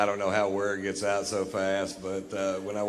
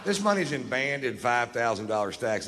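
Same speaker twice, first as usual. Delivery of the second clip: ordinary talk, nothing marked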